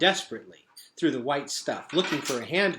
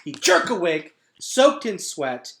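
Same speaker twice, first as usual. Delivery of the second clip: ordinary delivery, nothing marked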